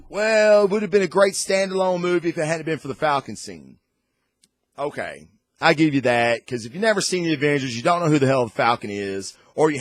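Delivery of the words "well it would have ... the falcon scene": high, fast mocking reviewer voice; mock critic. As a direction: parody a snooty reviewer. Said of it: "they're like".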